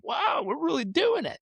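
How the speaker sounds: high, stretched wow of delight; high-voiced mock awe; retold amazement. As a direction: marvel at it in a high pitch. Amazed at his rising numbers, retold.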